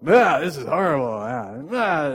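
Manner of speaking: put-on loud whiny kid complaining about dinner; hammed-up disgusted groaning; mock disgust. Mimicking kids disgusted at food.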